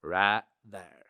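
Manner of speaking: low drawl placed in the jaw; Matthew McConaughey. Shown as a demonstration. Showing where the voice sits, low jaw-placed drawl. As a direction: let it settle low into the jaw. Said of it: "it curves up along the jaw and into this".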